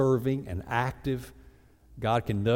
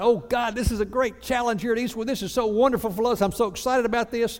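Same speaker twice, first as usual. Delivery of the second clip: mock excitement; 10 semitones higher than usual. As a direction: fake gushing prayer, pitched much higher. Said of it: "we get so excited".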